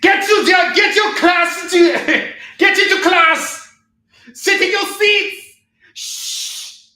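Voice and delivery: shrill, loud mock teacher yelling; stern teachers. Comic seller mocks his son's strict math teachers, shrieking. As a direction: bark orders like a strict school teacher. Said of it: "very stern".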